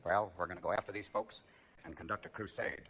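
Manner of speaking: very low, quiet folksy voice; hushed, deep mock presidential reply; George Bush. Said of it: "He said".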